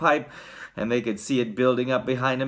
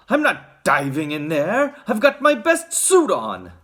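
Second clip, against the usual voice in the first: Mister Gloop; 5 semitones higher, 5 dB louder than usual. A narrator's reading. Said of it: "said Mister Gloop".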